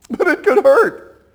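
high, drawn-out complaint; mock whining worrier. Preacher voicing a worried listener, high and whiny. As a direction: whine that it might hurt. Worrier voiced in back and forth.

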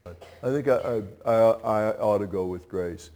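a lightly voiced, soft, halting churchgoer; hesitant listener. Preacher voicing a reluctant churchgoer, soft and halting. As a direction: stammer it out like someone reluctantly giving in.

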